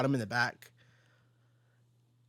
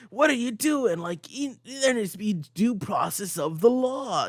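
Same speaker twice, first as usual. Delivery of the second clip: put-on indignant young Spider-Man; 8 semitones higher, slower than usual. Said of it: "He's not gonna be like".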